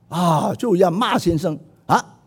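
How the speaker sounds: ordinary talk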